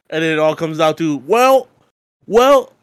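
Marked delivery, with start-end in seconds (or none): 1.2-2.7 mock a smug country Brit; country-British mix, slow and pitched up; country accent. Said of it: "I did that in a country accent".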